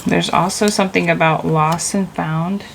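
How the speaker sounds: usual speaking voice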